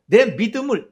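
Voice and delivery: usual speaking voice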